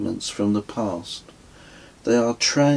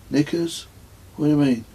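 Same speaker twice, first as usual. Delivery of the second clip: puzzled Kez; 5 semitones higher, much faster than usual. A narrator's reading. Kez voiced, puzzled question.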